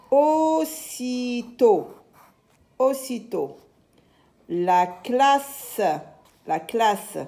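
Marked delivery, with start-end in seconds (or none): 0.1-1.9 very slow, higher, syllable-by-syllable reading; drawn-out syllables. Shown as a demonstration. Teacher models a word for young readers, drawn-out syllables. 4.5-5.8 lengthened, carefully separated sounds; drawn-out syllables. Shown as a demonstration. Teacher models a word for young readers, drawn-out syllables.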